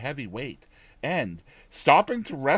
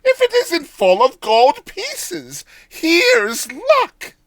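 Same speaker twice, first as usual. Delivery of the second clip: put-on high, loud, slow gleeful old woman; delighted old woman; 10 dB louder, slower than usual. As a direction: crow over the gold, overjoyed. Old woman finding gold.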